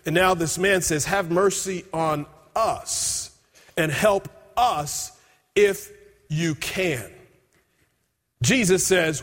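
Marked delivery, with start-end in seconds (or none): 1.0-7.1 slow pleading. Acted. Father's plea voiced.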